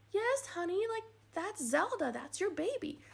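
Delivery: a hint of a mom soothing her daughter; lightly voiced mom.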